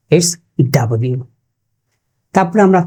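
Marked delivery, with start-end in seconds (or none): 0.7-1.2 ডাব্লিউ with an open "dab"; a lower "da-bliu" for ডাব্লিউ; correct pronunciation. Shown as a demonstration. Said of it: "ডব্লিউ বলতে কিছু নেই". (ডাব্লিউ, শুদ্ধ উচ্চারণ)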